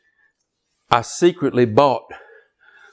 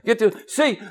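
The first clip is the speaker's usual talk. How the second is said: ordinary talk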